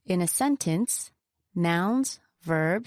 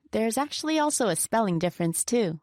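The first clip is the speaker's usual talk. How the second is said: ordinary talk, nothing marked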